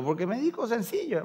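ordinary delivery

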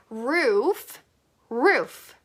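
normal talking voice

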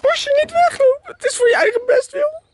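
high wailing plea; mock crying shout. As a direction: wail it like a hammed-up breakdown.

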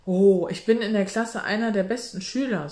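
leicht gereizt, etwas lauter; gereizter Ton. A narrator's reading. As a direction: gereizt abwehrend zum Vater. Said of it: "in gereiztem Ton". (leicht gereizter Ton)

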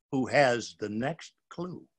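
ordinary delivery, nothing marked

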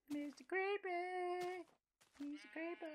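slow, high squeaky Mister Creeper. Shown as a demonstration. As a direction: stretch it out high and tiny.

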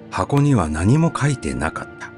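usual speaking voice

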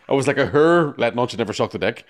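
normal talking voice

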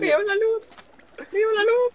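high mock voice. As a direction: squeak it like a cartoon. Joke about seeing light.

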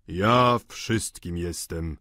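powolny, lekko odmieniony głos postaci; odpowiedź Collina. A narrator's reading. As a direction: say it very slowly, in a slightly altered voice. Collin odpowiada pani Vauquer. (wolno, z namysłem)

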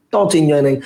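usual speaking voice

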